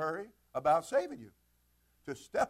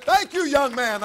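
loud slow thanks; 10 semitones higher, 11 dB louder, slower than usual. Retold or imitated. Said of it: "And he said".

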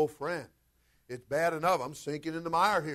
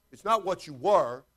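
usual speaking voice

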